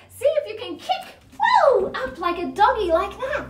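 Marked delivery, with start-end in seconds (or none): 1.4-1.9 high excited woo; playful whoop. Pretend. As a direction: cheer the kids on. Kicking up like a doggy.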